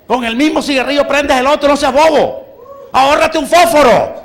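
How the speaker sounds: high, loud mocking voice of self-advice; mock scolding advice. Preacher mocking his old self, loud teasing scold. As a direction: scold yourself in a mock shout.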